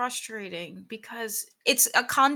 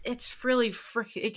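ordinary talk

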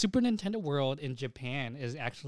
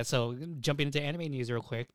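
usual speaking voice

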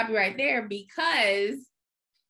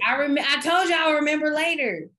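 loud, high burst of sudden recall; excited outburst; 6 semitones higher, 6 dB louder than usual. Real, not acted. Cutting in on co-host with a remembered show, excited. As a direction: blurt it out, excited and triumphant.

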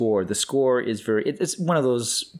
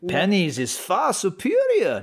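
slow, swooping, high comic voice; exaggerated snooty imitation; mock Nazi; 7 semitones higher, much slower than usual. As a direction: ham up a snobbish Nazi, stretching the words. Mock Nazi banter played for laughs.

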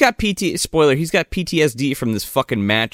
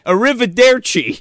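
deliberately clumsy Italian, pitched up; bad Italian accent; 9 semitones higher, 4 dB louder than usual. Host playing along with the joke, bad Italian accent. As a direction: fake Italian like an American faking it. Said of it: "really is like Brad Pitt from Inglourious Basterds".

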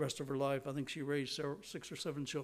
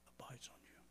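whisper; 21 dB quieter than usual. Acted. Warning of God's wrath.

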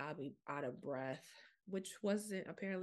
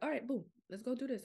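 higher, upbeat self-rallying; quick burst of mock get-up-and-go; acted-out pep; 7 semitones higher, 3 dB louder than usual. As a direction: play at psyching yourself up to get moving. Acts out her newfound get-up-and-go.

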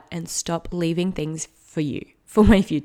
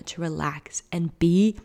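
whispered; slower than usual. Real, not acted. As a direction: breathe the words out gently. Whispered advice to future self.

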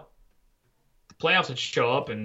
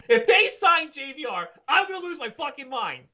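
worked-up rant; 11 semitones higher than usual. Real, not acted.

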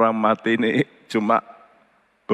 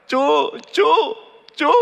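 retold cry; slower than usual. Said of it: "dia teriak".

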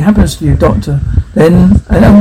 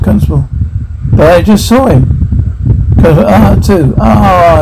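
ordinary talk

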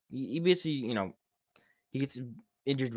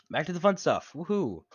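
cheerful woohoo; 5 semitones higher, 4 dB louder than usual. Real, not acted.

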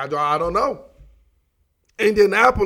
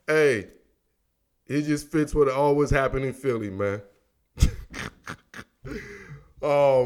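ordinary talk, nothing marked

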